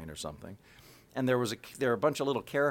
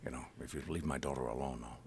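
low, quiet menacing threat; a hushed, deadly calm warning; gravely quiet Taken-style threat; Liam Neeson impression; 8 semitones lower, 9 dB quieter than usual.

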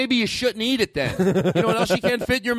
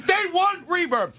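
shouting demand; 13 semitones higher than usual. Acted. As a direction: yell it like a spoiled star.

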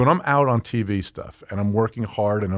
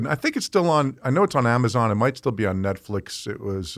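ordinary talk

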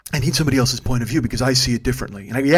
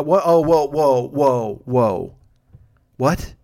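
louder, higher stunned whoa, trailing down; shocked whoa; 3 semitones higher, 4 dB louder, much slower than usual. Retold or imitated. Driver hearing his passenger's girlfriend has a child, stunned. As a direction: stop him cold in disbelief.

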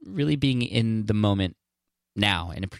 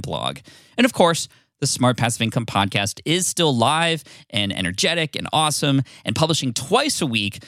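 higher, louder, projected host announcement; podcast announcement; 6 semitones higher, 6 dB louder than usual. Real, not acted. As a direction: address all the listeners with lifted, emphatic energy. Recorded show announcement.

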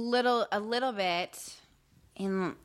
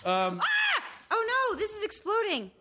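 high, startled cry of alarm; startled shout; 10 semitones higher than usual. Real, not acted. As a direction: yelp as the bottle bursts. Drink bottle suddenly explodes.

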